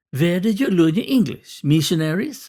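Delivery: a hint of a Norwegian boss asking; Norwegian accent. A narrator's reading. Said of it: "in a distinct accent I would later learn was Norwegian".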